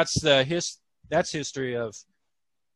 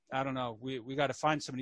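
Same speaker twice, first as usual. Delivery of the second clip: hushed; 3 semitones lower, 11 dB quieter, faster than usual. Retold or imitated. Hushed reply in story.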